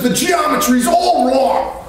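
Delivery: high, slow mock shout of a cop. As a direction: bark it out like an irritated traffic cop. Cop scolding about the Jeep.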